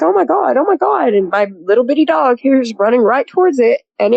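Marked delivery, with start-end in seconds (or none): 0.0-1.1 high-pitched retold panic; mock alarm. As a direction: imitate a friend's alarmed exclamation. Friend alarmed as Sasquatch approaches.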